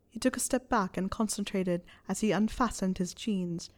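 plain reading voice

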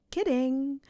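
a mischievous lilt, teasing him. A narrator's reading. Hermione teases her sulking boyfriend, mischievous. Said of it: "said with a mischievous smile".

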